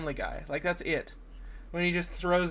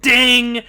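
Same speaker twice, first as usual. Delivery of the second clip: loud, high sung-out "ding"; mock ding; 6 semitones higher, 11 dB louder than usual. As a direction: hit a big comic ding. Imitated ding after long stair climb.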